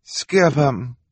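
brushing it off, high and airy; dismissive. A narrator's reading. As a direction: wave it away like it's beneath you. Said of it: "Esme said dismissively".

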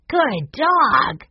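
put-on high, bright-eyed boy praising his pet; praising a dog.

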